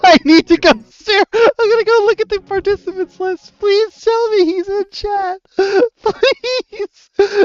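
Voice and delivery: high, squeaky helpless laughter through the words; real breathless high giggling, nearly crying; laughing. Real, not acted. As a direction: plead while cracking up. Helpless laughter, begging he's in chat.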